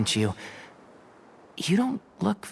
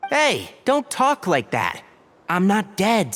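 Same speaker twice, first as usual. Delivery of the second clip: higher, slow, flustered objection; exasperated protest; 7 semitones higher, slower than usual. Acted. Tighnari scolding Sino for his deathbed joke, exasperated. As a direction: push back at the joke, half laughing.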